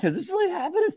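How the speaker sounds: very high, astonished question; disbelief. Retold or imitated. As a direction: squeak it out in amazed disbelief. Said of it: "I was like".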